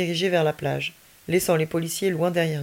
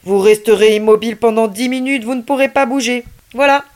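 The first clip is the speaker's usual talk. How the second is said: louder, eerie command, rising higher; strange, commanding voice; 4 semitones higher, 10 dB louder than usual. Acted. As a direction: freeze them with an uncanny order. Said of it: "d'une voix très étrange et autoritaire".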